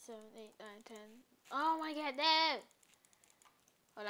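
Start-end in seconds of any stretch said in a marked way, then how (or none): 1.5-2.6 loud dismayed yelp, higher and louder; alarmed outcry. Real, not acted. While building, he cries out in real alarm at a mistake. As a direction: cry out as the build goes wrong.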